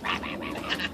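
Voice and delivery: loud, rough mock barking; barking dog imitation. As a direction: bark like an overexcited dog. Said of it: "he's like".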